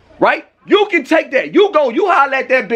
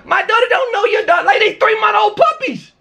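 higher-pitched, incredulous yell; raised voice, indignant; outraged shout; 6 semitones higher than usual. Real, not acted. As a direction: shout it in disbelief. Outraged at neighbor's claim.

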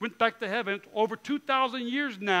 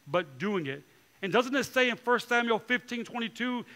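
normal talking voice

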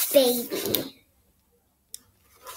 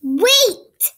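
higher, louder sharp call to stop; sudden halt; 5 semitones higher, 5 dB louder than usual. Acted. Reader halting the children before the big question, higher and louder. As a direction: stop the listener in their tracks.

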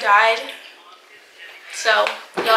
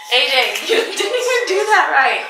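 laughing; 5 semitones higher than usual. Real, not acted. Laughing at failed game.

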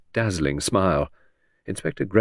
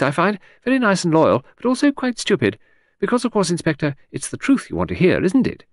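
high, breezy, rambling society lady; vague aristocratic lady; 7 semitones higher, 3 dB louder than usual. A narrator's reading. Lady rambling cheerfully to the inspector, high and airy. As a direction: chatter on brightly as a scatterbrained aristocrat. Voices Lady Angkatell at length.

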